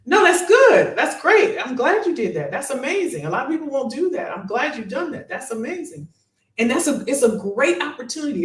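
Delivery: high, bright bursts of praise with a laugh; excited praise. Real, not acted. Coach praising a viewer who took initiative, excited and high.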